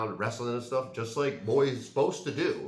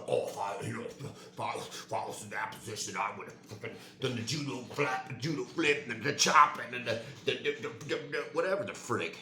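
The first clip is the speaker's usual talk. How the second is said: a hint of a braggart who has never fought; mocking a wannabe fighter; 4 dB quieter than usual. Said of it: "a guy who's never fought. he doesn't do the".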